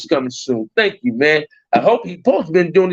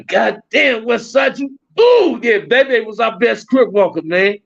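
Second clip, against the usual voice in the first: high, exclaiming, thrilled to remember; excited recognition; 9 semitones higher, slower than usual. Real, not acted. Streamer remembering his crew's best dancer, excited exclamation.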